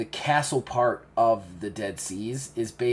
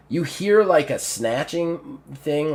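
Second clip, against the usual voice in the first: ordinary talk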